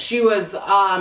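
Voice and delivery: ordinary talk, nothing marked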